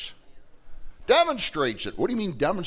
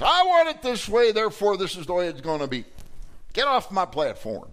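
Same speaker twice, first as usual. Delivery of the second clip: selfish demanding partner; 6 semitones higher, 4 dB louder than usual. Acted. Acts selfish partner quote.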